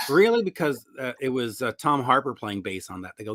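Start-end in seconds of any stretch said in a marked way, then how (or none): 0.1-0.4 high teasing really; mock surprise. Producer teasing a fan, high mock surprise. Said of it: "and you go".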